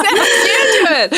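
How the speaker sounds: high, drawn-out mock yell; mock exasperated shout. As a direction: shout it slowly through laughter, pretending. Retold angry line to husband.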